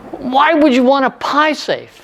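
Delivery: high, slow incredulous question; out loud. Retold or imitated. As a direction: blurt the puzzled question aloud. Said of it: "out loud".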